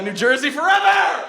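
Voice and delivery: loud, high cheer to the crowd; rousing crowd-pleasing yell; rallying shout. Real, not acted. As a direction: shout it out like a hometown rallying cry. Rallying the live audience.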